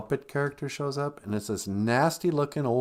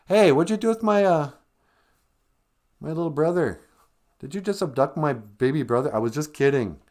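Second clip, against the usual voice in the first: put-on panicked teen girl; 6 semitones higher, 5 dB louder than usual. Girl confronts Goblin King.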